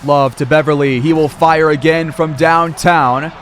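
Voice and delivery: ordinary talk, nothing marked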